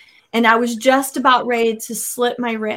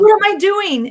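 high-pitched startled question; reliving the shock at oneself; raised, alarmed self-questioning; 7 semitones higher, 4 dB louder than usual. Retold or imitated. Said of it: "I was startled".

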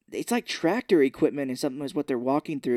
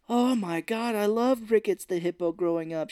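a touch of raised-pitch gushing; mildly gushing, higher voice; mock delighted fan; 6 semitones higher than usual. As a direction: play her tipsy excitement lightly. Acting out drunk woman's delight.

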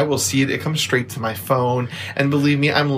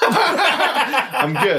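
laughing refusal; 12 semitones higher than usual. Acted. Refusing meth offer, laughing.

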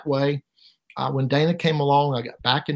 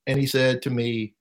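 usual speaking voice

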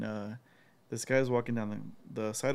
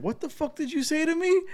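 high, loud retold threat; mock street-tough challenge; aggressive challenge; 5 dB louder than usual. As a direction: square up to him, voice pitched way up. Stranger confronting tour guide.

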